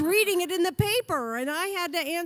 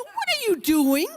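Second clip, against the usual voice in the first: higher and louder retold alarm; alarmed question; 6 semitones higher, 6 dB louder than usual. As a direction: demand it, alarmed. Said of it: "I go".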